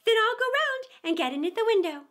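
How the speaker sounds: rabbit voice. A narrator's reading. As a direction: rabbit talking to himself at the door. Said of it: "Alice heard it say to itself".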